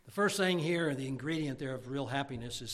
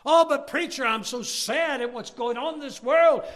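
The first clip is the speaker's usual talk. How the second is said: high, loud, wailing complaint; mock whining congregant; 12 semitones higher, 13 dB louder than usual. Congregant complaining to the preacher, high mock wail. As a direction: whine it like a gloomy churchgoer. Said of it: "you say".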